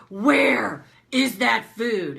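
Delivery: loud, drawn-out mock bellow of an irritated husband; frustrated demand. Husband bursting into the bedroom at night, imitated frustrated bellow. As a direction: imitate a forceful, exasperated demand, stretching each word. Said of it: "he's frustrated".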